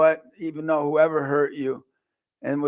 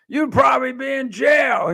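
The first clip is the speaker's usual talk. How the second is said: put-on a cheeky kid heckling the speaker; cocky kid; 8 semitones higher than usual. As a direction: blurt it out like a cocky teenager. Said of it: "this cocky kid".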